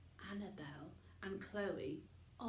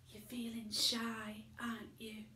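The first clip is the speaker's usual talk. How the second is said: whispered; 2 semitones higher than usual. A narrator's reading. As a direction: hush it to her. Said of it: "whispered Dad".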